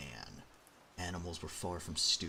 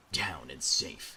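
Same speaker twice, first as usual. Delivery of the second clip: Solid Snake voice; 2 semitones lower, 6 dB louder, much slower than usual. Shown as a demonstration. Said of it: "let me do my better Solid Snake impression".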